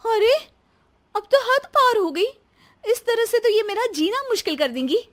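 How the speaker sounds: very high, exasperated wail of words; outraged daughter-in-law. Acted. Daughter-in-law fuming after mother-in-law walks off, outraged. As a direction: fume in a high pitch.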